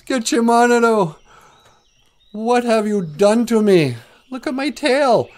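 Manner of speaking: high, loud hammed-up wail; crying plea. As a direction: wail up to the Creator in mock despair. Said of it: "He starts to cry to Gitchimanadu".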